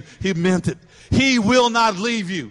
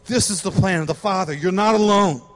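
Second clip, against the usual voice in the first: ordinary talk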